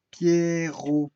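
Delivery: "Pierrot" split into pier-ro; two-syllable breakdown. Shown as a demonstration. Teacher counting two syllables, "Pierrot" pulled apart. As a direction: hold both syllables of "Pierrot". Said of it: "Il y a deux syllabes".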